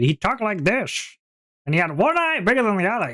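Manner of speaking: put-on high, swooping old-man shopkeeper; weird old man. Game master demonstrating his beloved shopkeeper's voice, weird old man. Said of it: "He just talked like a weird old man".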